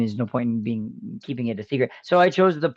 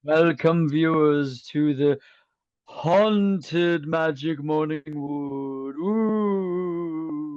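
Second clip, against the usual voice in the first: spooky horror host; much slower than usual. Acted.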